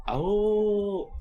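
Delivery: high howling "Ow"; wolf howl. Pretend. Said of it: "everyone hears a loud wolf's howl".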